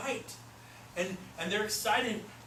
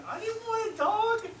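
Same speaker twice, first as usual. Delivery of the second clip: high-pitched comic exasperation; helpless protest; 14 semitones higher than usual. Retold or imitated. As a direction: wail it up high, half joking. Comic protest about nearly buying.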